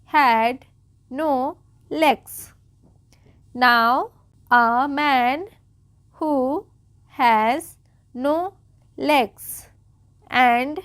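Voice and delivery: single words with long gaps between; slow read-aloud. Shown as a demonstration. Teacher reading the story to beginners, slow separated words. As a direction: sound out each word slowly and clearly. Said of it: "बिल्कुल आराम आराम से पढ़ रही हूँ".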